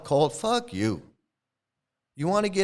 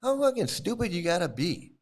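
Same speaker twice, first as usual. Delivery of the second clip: ordinary delivery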